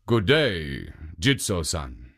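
a hint of a low ogre. Ogre greets the statue.

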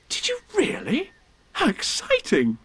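high, airy whispered amazement; breathless wonder. Acted. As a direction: gasp it out, thrilled for a friend. Christopher Robin reacts to Eeyore's news.

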